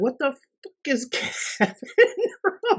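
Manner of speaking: high-pitched, laughing incredulity; laughing disbelief. Retold or imitated. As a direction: crack up while protesting in a squeal. Baffled reaction to random opening quote.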